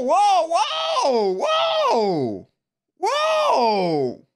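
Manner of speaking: ordinary talk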